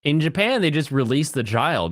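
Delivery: usual speaking voice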